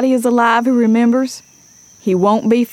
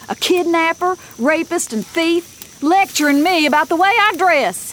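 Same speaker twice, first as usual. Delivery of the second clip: indignant, pitched up high; seething indignation; 7 semitones higher than usual. Acted. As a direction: bristle with outrage at the insult. Julie seething about Tom's comments.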